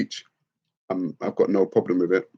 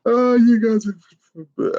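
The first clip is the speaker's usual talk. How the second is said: laughing exclamation; 14 semitones higher, 5 dB louder, much slower than usual. Real, not acted. Laughing reaction to chat.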